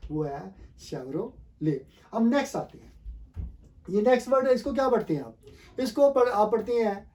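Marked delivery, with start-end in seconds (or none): 0.8-1.8 शेवरोले drawn out slowly as "shev-ro... lay"; softer, slow model of शेवरोले, ending in "lay"; correct pronunciation. Shown as a demonstration. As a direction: say शेवरोले with no final t. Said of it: "correct pronunciation है".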